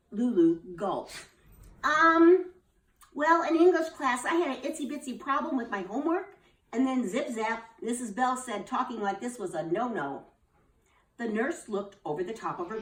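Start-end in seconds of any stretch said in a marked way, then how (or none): 1.8-10.3 put-on high, loud little-girl voice, then fast; hesitate, then rush it; nervous girl. Lulu nervously explains to the nurse, high child voice.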